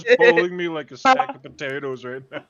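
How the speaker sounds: loud, excited and amused; laughing about being dragged away. Real, not acted. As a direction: exclaim it in delight.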